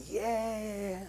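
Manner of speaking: a high, bright mock cheer; celebrating. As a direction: cheer the child's first bite. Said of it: "He starts celebrating".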